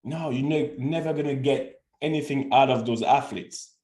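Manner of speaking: ordinary delivery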